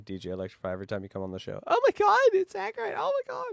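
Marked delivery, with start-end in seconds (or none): 1.7-3.4 squeaky, high mock gasp of delight; imitating her squeals. Cohost mimics the swooning woman's squeal, high and giddy. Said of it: "I need you to make those sounds for me".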